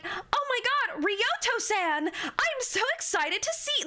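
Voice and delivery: high, gushing anime-girl imitation; mock an over-excited dubbed anime girl. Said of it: "No human woman talks like that".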